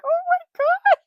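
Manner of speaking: horrified gasp. Retold or imitated. After the phone hits boyfriend's face, shocked shriek. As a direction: yelp in sudden horror.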